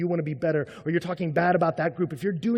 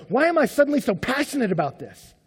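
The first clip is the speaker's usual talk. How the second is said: raised, loud and impassioned; loud, incredulous self-check; worked-up self-questioning; 7 semitones higher, 6 dB louder than usual. Retold or imitated. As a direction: question yourself with rising intensity. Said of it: "I'll start praying fervently".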